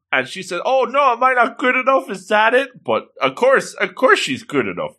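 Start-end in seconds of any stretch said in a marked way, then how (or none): 0.6-2.8 high-pitched anxious pleading; hammed-up worried whimper; mock distress. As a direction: fret that you are being rejected. Acted worry at being rejected.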